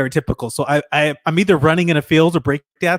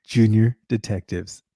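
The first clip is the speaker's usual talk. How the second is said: jingle read; 5 semitones lower, 3 dB quieter than usual. Shown as a demonstration. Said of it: "you were supposed to say".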